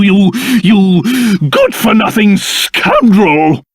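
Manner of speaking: ordinary talk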